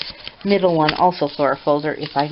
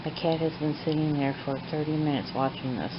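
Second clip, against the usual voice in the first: hushed late-night talk; 12 dB quieter than usual. Real, not acted. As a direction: talk quietly as if it's midnight. Late night, watching the cat quietly.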